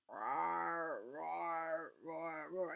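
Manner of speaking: half-hearted lion roar fading to a whisper; a failed roar that fades; weak roar. Acted. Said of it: "I can't roar".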